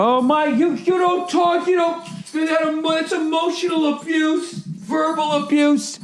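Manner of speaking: high, soft, prissy mock of a fussing parent; mocking whiny parent. Mocking a modern parent who objects to the shutting up, in a high whiny voice. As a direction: sneer at the parent by squeaking their complaint slowly.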